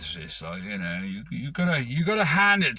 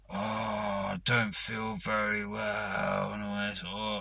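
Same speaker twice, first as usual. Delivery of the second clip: fake sick voice; 4 semitones lower, 7 dB quieter, slower than usual. Phoning boss, faking illness.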